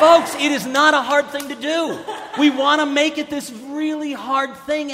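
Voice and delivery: loud, high preaching shout; fired-up preacher shout. Real, not acted. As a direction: call it out to the whole room.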